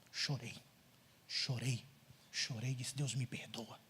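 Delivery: low, quiet and remorseful; somber confession. Retold or imitated. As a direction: drop the voice low, pausing heavily between phrases. Confesses weeping and asking forgiveness. (confissão pesarosa, em voz grave e baixa)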